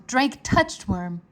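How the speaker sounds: plain reading voice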